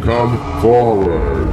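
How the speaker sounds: slow, drawn-out command; imperious Neptune. Acted. Neptune summons the petitioner, slow and commanding. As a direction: summon them slowly, like a mighty god.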